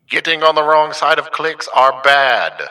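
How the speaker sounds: loud, high announcement through a megaphone. Acted. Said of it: "broke out the megaphone voice effect".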